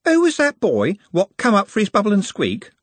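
lightly voiced airy girl; a breezy, casual question; nonchalant young woman. A narrator's reading. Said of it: "she asked nonchalantly".

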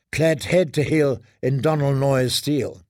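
usual speaking voice

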